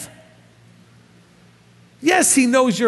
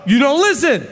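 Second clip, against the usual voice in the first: raised, exasperated parent scolding; a parent snapping at a child who won't listen; 4 semitones higher than usual. Retold or imitated. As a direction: scold the kid, fed up. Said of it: "You go".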